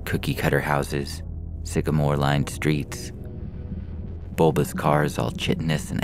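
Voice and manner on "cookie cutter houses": ordinary delivery, nothing marked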